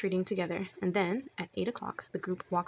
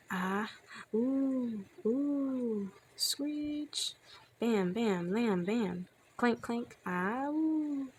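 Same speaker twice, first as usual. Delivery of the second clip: high ghostly oohs and screech with bams and clanks; weird warbly haunting noises; 4 semitones higher, much slower than usual. A narrator's reading. Noises from inside the haunted house, weird warbly wailing. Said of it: "Weird, warbly, clanky noises".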